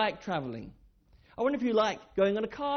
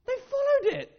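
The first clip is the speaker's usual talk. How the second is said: wide-eyed wonder; 5 dB louder than usual. Acted. Wonder at following a star.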